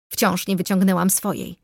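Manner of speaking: ordinary delivery, nothing marked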